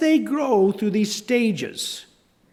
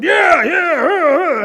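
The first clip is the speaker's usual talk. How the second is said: slow, much higher shouting yeahs; shouted cheer; 10 semitones higher, 8 dB louder, much slower than usual. Real, not acted. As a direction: cheer it out long and loud. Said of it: "are going".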